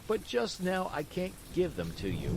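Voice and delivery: put-on lightly teasing boy; a touch of mockery; poking fun. A narrator's reading. Boy teases Pinocchio, mild mockery. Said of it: "poking fun at him".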